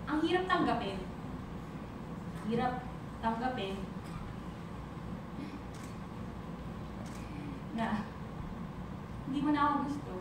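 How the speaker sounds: soft, low confession with long pauses; subdued heartbreak. Acted. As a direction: sink into a quiet, halting hurt. (mahina at malungkot na pag-amin)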